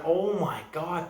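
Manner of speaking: slow, high-pitched cry of disbelief; re-enacted shock. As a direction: relive the shock, stretching the words upward. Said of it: "I went".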